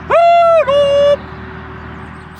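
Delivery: high, loud oh no of dismay. Real, not acted. Storyteller interrupted by tractor, loud dismayed oh no. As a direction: wail it as the noise returns.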